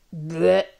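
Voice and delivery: loud mock burp; voiced ogre belch cutting off speech; acted loud burp. A narrator's reading. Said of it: "Un rôle l'interrompit".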